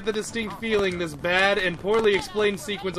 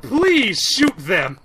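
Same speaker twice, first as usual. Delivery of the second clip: loud exasperated plea; 8 semitones higher, 6 dB louder, slower than usual. Real, not acted. Frustrated at the game.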